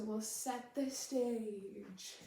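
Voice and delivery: line stretched out slowly, stage held long. Shown as a demonstration. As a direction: draw it out at half speed. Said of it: "you say it a little slower".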